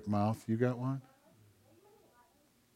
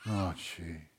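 eye-roll scorn; 11 semitones lower, 18 dB quieter than usual. Retold or imitated. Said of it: "The eye roll".

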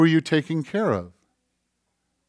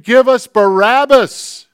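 loud, slow mock crowd yell; crowd shouting; 9 semitones higher, 9 dB louder, slower than usual. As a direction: call out like the mob demanding Barabbas. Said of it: "raised your hand and screamed".

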